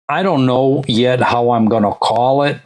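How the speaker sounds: normal talking voice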